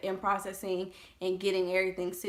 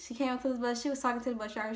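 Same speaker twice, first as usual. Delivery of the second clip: usual speaking voice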